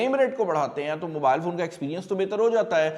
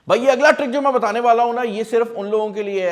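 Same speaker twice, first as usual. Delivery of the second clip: louder, fast, pumped-up build-up; excited hype; 6 semitones higher, 8 dB louder than usual. Real, not acted. Vlogger hyping his next trick to viewers, excited and fast. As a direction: sell the next trick with energy.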